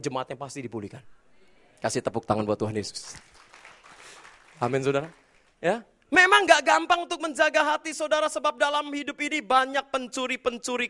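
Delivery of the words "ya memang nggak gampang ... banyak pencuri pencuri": loud, high call to the church; preaching shout. Real, not acted. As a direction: lift the voice over the crowd. Preacher raising voice. (seruan lantang ke jemaat)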